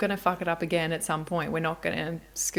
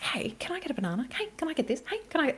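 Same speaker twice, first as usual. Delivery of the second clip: put-on high whispered child nagging, climbing higher; pestering child; 8 semitones higher, 5 dB quieter, faster than usual. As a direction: imitate a kid begging nonstop. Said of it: "she's like".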